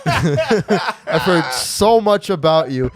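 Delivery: slow, high, laughing gush; laughing mock greeting. Mimicking Tyler's greeting through laughter. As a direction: drag it out while cracking up. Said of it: "you look at her and you go".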